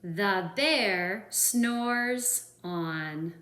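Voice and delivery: drawn out, very slow refrain. Acted. Reader drawing out the refrain for kids, slow. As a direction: stretch it like a sleepy refrain. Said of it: "remember this part?".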